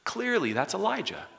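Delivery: high, loud, knowing exclamation; mock crowd certainty. Crowd eagerly naming Elijah, high and loud. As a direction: sound sure it's Elijah. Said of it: "they're like".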